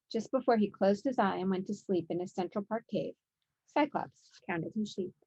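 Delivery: ordinary delivery, nothing marked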